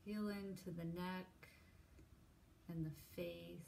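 unhurried, soft, even meditation cueing; guided meditation. Real, not acted. Leads a body scan meditation.

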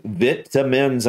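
vitamins slowly, short i, VIT-uh-mins; British pronunciation. Shown as a demonstration. Reactor testing why someone could say it so, British pronunciation. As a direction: draw out vitamins with a first syllable like "vit". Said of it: "I can see why someone could".

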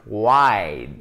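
"Wide" with a big open wa-ee vowel; mouth wide open. Shown as a demonstration. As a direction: open the jaw fully on "Wide". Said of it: "Il faut vraiment ouvrir la bouche".

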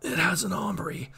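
whispered weak wounded man; dying voice. Acted. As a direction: breathe it out, fading. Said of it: "a dying voice".

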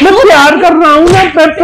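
high, loud whiny protest; mock whine. Husband whining to angry wife, high mock-crying protest. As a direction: whine like a sulky child.

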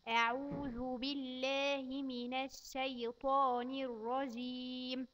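put-on slow, careful recitation for a child to repeat. Shown as a demonstration. Teacher reciting slowly for a child to repeat. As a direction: spell out each word slowly for the child. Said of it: "نسمعها تاني".

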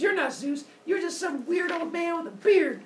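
high-pitched, indignant scolding; acted high, angry rant; angry accusation. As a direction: hurl it as an insult. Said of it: "getting all grumpy and angry and unhappy".

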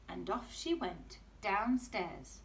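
ordinary delivery, nothing marked

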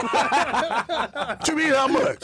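high, very slow, laughing disbelief; mock outrage. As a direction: stretch it out in shocked disbelief, laughing.

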